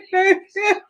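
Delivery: a high, giddy laugh; tearful laugh. Real, not acted. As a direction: laugh off the tears. Laugh after quoting herself crying.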